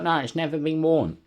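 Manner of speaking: high, insistent seller voice; imitating insistent seller. Imitating the seller who insists the shirt is unworn, high and insistent.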